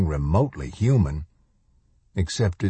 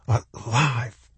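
higher, hushed wonder on one word; awed exhilaration; 4 semitones higher than usual. A narrator's reading. Boy realising he survived, awed exhilaration. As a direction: let the word swell with amazed relief. Exhilarated thought, repeated in awe.

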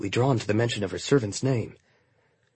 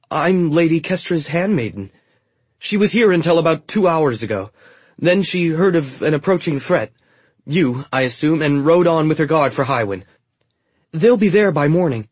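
higher, louder quick-talking handmaiden; eager handmaiden; 5 semitones higher, 6 dB louder than usual. A narrator's reading. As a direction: cover the lie with eager chatter. Trina lies to the soldiers.

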